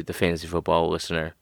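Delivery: ordinary talk, nothing marked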